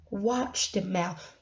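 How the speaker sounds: slow, soft hushed warning; a slow, quiet word of caution. Real, not acted. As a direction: lean in and warn them quietly. Warning the audience about dirty talk.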